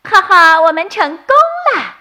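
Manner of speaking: high, loud triumphant cheer; happy cheer. A narrator's reading. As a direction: shout with joy at catching the wolf. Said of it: "高兴地叫道". (小兔们高兴地欢呼)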